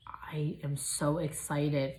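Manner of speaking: slow hushed whisper; slow excited whisper; whispered excitement. Real, not acted. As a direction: whisper it like a secret thrill. After squealing.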